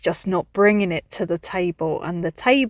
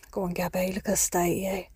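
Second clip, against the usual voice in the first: muttered self-scolding; 8 dB quieter, faster than usual. Retold or imitated. Woman quietly scolding herself in the heat, muttering. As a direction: grumble it under your breath at yourself. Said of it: "So I was like".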